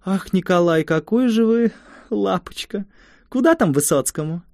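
put-on affected girlish voice. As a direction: coo it like a coy village girl. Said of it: "особенно жеманный голос".

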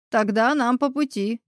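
plain reading voice

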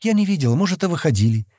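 high-pitched hesitant answer; mildly unsure girl's reply; uncertain. A narrator's reading. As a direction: answer without conviction. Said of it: "неуверенно ответила Сатти".

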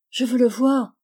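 insistent demand. Acted. Charlotte insists on seeing him.

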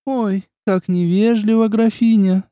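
high, slow pouting reproach; mock reproach. A narrator's reading. Ines teasing the countess, mock reproachful. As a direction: pout with fake offence. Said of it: "с наигранным осуждением надула губы Инес".

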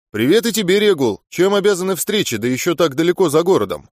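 ordinary delivery

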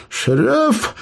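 breathless high call; gasping, out of breath from running. Acted. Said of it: "попытался отдышаться Эбер".

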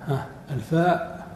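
ordinary delivery

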